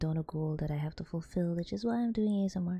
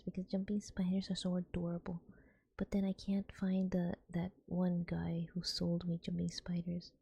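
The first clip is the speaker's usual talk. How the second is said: soft breathy ASMR whispering; 4 dB quieter than usual. Acted. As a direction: whisper gently into the mic.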